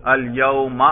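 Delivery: الیوم with a voiced -a ending; accusative ending demo. Shown as a demonstration. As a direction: pronounce الیوم as "al-yauma". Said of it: "اس کے نصب پر ہم تلفظ کریں، کر کے دکھائیے".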